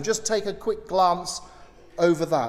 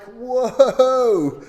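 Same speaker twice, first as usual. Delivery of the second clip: stunned whoa; 6 semitones higher, 6 dB louder than usual. Real, not acted. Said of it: "reacts to the slaying verse".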